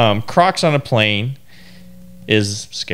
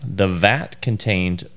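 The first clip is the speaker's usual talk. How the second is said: normal talking voice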